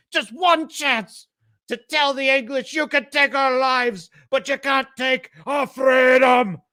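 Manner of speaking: high, building to a shouted climax; rally the troops, rising to a shout; rousing speech. Retold or imitated. Wallace rallying the Scots before battle, swelling to a shout. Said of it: "he gives this amazing speech".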